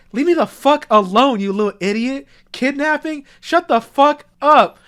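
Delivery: hammed-up furious brush-off; mock angry outburst. Dad rejects a child's plea, exaggerated angry retort.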